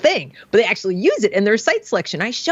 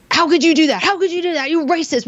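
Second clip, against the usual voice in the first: higher-pitched, heated retold outburst; mock yelling; 8 semitones higher than usual. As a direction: imitate a kid yelling accusations in someone's face. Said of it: "Starts screaming at her".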